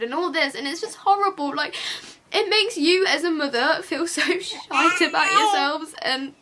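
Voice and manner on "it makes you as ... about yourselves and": laughing through real tears; teary half-laugh that breaks down; crying. Real, not acted. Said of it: "sorry I'm crying".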